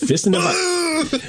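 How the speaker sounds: high squeal through laughter; cracking up mid-joke; laughing. Real, not acted.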